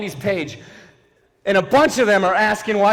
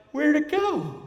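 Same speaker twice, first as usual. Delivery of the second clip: high, soft puzzled deer; deer impression; 8 semitones higher, 5 dB quieter, slower than usual. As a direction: squeak it like a baffled deer. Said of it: "That was my impression of a deer".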